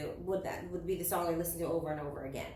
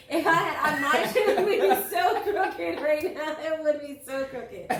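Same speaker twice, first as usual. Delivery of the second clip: high laughing through the words; giggling at her own joke; 7 semitones higher, 6 dB louder than usual. Real, not acted. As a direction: crack up while picturing it. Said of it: "laughing while picturing crooked mustache".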